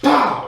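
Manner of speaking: loud, high "Pow!" mimicking a belt strike; imitated whack. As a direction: punch out the sound of the hit. Retelling the belt blows.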